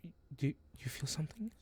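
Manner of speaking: hushed, fast whisper. Acted. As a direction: whisper it as if something is near. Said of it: "she stops".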